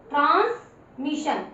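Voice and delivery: ट्रांसमिशन drawn out slowly; slow demo reading. Shown as a demonstration. Teacher reading aloud for pupils, slow. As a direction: stretch ट्रांसमिशन syllable by syllable.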